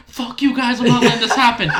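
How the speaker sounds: high, loud mock outburst; shouted, high-pitched retold defiance; defiant outburst. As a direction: imitate a friend refusing in a panic. Friend's outburst acted out.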